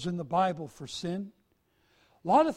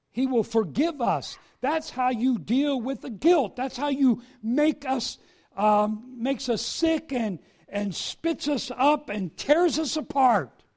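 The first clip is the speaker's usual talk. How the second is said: louder, higher fervent preaching; preaching at full force; 5 semitones higher, 6 dB louder than usual. Acted. Preacher to congregation on guilt, fervent full-force preaching. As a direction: drive the point home to the congregation.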